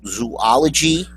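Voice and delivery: "zoology" slowly as zoo-AH-luh-jee; slow, questioning "zoology", zoo-AH-luh-jee; drawn-out pronunciation demo. Shown as a demonstration. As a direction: sound out "zoology" with a separate AH after zoo. Demonstrating ways to say zoology.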